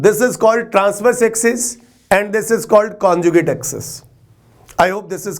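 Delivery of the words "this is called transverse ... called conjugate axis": slow dictation. Shown as a demonstration. Teacher dictates key terms.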